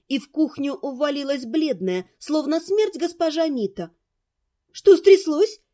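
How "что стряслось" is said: louder, higher, urgent question; alarmed exclamation. A narrator's reading. Mother exclaims at the frightened neighbour, alarmed. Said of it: "воскликнула мама".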